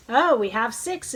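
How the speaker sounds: usual speaking voice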